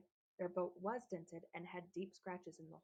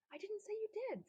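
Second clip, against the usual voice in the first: defensive retort; 13 semitones higher than usual. A narrator's reading. Frederick answers defensively.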